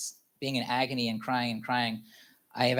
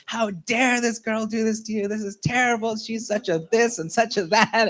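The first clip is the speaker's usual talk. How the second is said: higher, indignant sister voice, half laughing; mock angry sister; 8 semitones higher than usual. The sister fuming at the ex, mock indignant. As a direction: play the outraged sister lightly. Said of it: "reacted with a lot of, like, anger".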